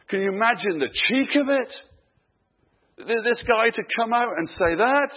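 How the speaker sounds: high, loud, incredulous outburst; acted affronted exclamation; indignant disbelief. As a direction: rise in outrage at the cheek. Imagined reaction of mocked sufferer.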